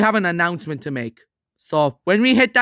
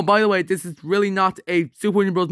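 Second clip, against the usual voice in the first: ordinary talk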